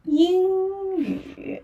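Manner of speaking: 英语 said high and clear, 英 on a level first tone; Mandarin demo. Shown as a demonstration. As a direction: model 英语 carefully, 英 high and flat. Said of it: "that is".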